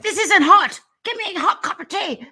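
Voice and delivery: mock the guru scolding over cold tea; hammed-up petulant complaint; demanding guru. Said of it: "he went".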